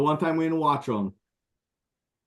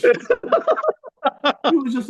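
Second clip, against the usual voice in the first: higher, slow, laughing mock sob; mock teary daughter; 10 semitones higher, 4 dB louder, slower than usual. Dad imitating his emotional daughter at the movie, slow high mock sob.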